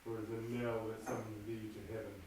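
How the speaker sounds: low, soft brooding murmur to himself; hushed soliloquy. Acted. Macbeth alone before the murder, low and hushed.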